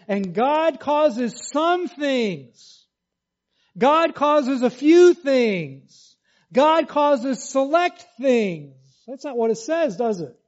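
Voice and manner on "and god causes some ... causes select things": higher, slow, louder misquote; stressing some, few, select; mock wrong reading. Shown as a demonstration. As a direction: read the wrong version with pointed irony. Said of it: "Notice it doesn't say".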